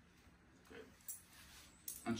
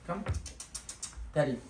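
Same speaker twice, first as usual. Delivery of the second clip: usual speaking voice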